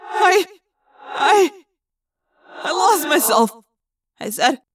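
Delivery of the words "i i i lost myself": shaken stammer. Acted. Shaken after losing himself.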